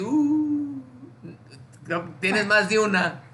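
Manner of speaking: high-pitched teasing reproach; mock disapproval. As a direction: act out a gatekeeper finding a problem. Acts heaven's gatekeeper spotting a record.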